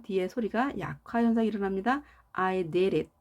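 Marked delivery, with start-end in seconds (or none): 2.3-3.1 American flap on "did it", "I di-rid it"; flapped d. Shown as a demonstration. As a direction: say "did it" linking the second d as a light tap. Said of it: "R처럼 발음하면 되겠습니다".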